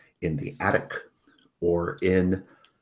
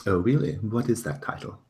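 a hint of a pompous host, raised; lightly voiced fussy lord; 2 semitones higher, 7 dB louder, faster than usual. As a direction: ask with mild pompous charm.

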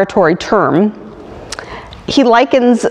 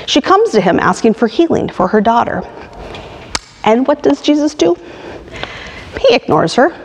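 usual speaking voice

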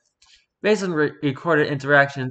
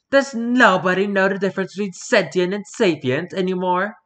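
indignant pigeon voice; 5 semitones higher than usual. Acted. Pigeon's indignant note in document.

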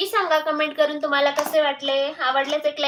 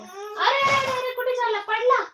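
sudden high cry of alarm; startled alarm; 9 semitones higher than usual. Real, not acted. Child suddenly falls.

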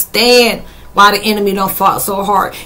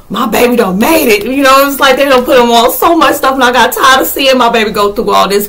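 higher, elated cheer for someone dear; proud celebrating; 5 semitones higher, 5 dB louder than usual. Acted. Spirit family celebrating a loved one's success, proudly excited. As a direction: act out a thrilled, proud parent.

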